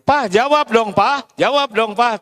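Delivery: wife urging husband to reply; demanding wife. Retold or imitated. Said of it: "Coba Ibu".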